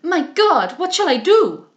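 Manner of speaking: loud, high cry of distress; calling out. A narrator's reading. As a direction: cry out for help with a foreign accent. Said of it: "calling out".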